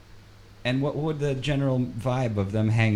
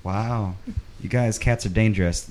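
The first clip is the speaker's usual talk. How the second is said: usual speaking voice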